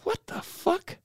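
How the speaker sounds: high, soft squawky parrot voice; imitating a parrot; parrot mimic. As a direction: squeak it like a bird copying you. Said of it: "where you're like".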